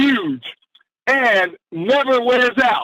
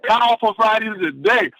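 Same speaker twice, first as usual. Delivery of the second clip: usual speaking voice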